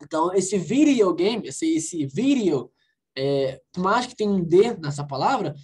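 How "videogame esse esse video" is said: "videogame" and "video" with the D tapped like an R. Shown as a demonstration. Teacher explaining the English D to students, "video" with tapped D. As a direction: say "video" slowly in English, "vi-rio". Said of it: "pronuncio como se fosse um R".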